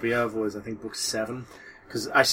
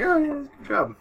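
high, sing-song fake praise; mock-impressed listener; mock cheerful praise. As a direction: pretend to be impressed, brightly. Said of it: "I was just like".

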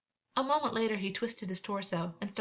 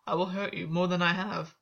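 warningly. A narrator's reading. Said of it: "Gwen said, warningly".

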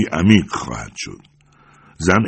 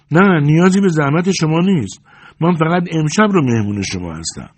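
high, raised character voice; higher, projected woman's reply; woman calling back; 10 semitones higher, 5 dB louder than usual. A narrator's reading. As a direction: answer loudly through a wall. Woman answers monk through wall.

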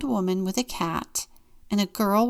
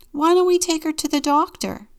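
put-on eager boy; 10 semitones higher, 7 dB louder than usual. Boy suggests doctor eagerly.

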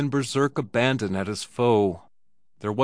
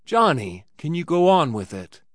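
anxious; 5 semitones higher, 5 dB louder than usual. Acted.

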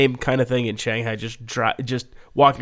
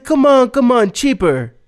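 much higher, louder mock haggling; wheedling masseuse; 10 semitones higher, 6 dB louder than usual. Masseuse cuddling Steve and haggling, high mock wheedle.

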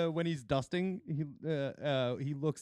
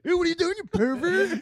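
mock disgusted; 11 semitones higher, 7 dB louder than usual.

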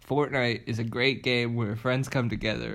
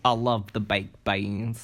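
slow mock Boston accent; 3 semitones lower, 3 dB quieter, slower than usual. Co-host gushing about baked beans, slow Boston accent. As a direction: draw it out in a put-on Boston accent. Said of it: "so that's the accent".

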